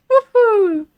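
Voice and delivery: high, loud woo-hoo; drawn-out falling cheer; celebratory whoop. Real, not acted. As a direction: whoop in triumph. Celebrates after reading the German.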